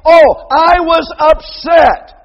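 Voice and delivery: slow, loud, high mock whine. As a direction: drag it out as a mock excuse. Mocking excuse.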